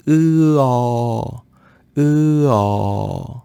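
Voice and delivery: E held, then opened into a long O; mouth opening wide. Shown as a demonstration. Teacher explaining a sound hard for French speakers, mouth opening. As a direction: sustain E and widen the mouth into O. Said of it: "ouvre la bouche grand".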